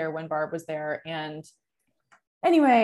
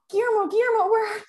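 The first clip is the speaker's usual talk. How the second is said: high, loud panicked calls for a lost cat; hammed-up panic, pitch way up; mock frantic calling; 14 semitones higher, 5 dB louder, faster than usual. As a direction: re-enact freaking out, calling out high. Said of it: "we're all freaking the fuck out".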